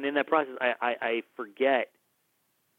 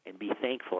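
gentle rebuke; 2 semitones lower, 7 dB quieter than usual. Retold or imitated. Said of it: "he was like".

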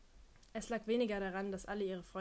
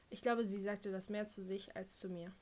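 plain reading voice

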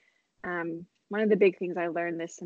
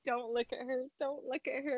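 softly retold urgent reminder; hushed self-warning; 3 semitones higher, 8 dB quieter than usual. Friend recalls avoiding eye contact before crying, hushed self-warning. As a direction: quote it under the breath, softer. Holding back tears, telling herself.

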